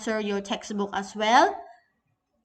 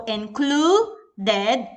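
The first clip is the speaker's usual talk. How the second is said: included very slow, CLU stretched out and rising; included with a long, emphatic middle syllable; long stressed syllable. Shown as a demonstration. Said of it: "it's in word stress because it's long".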